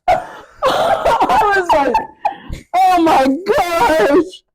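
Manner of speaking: high, slow words through laughter; laughing in despair at the relapse; laughing disbelief. Real, not acted. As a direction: drag it out while laughing.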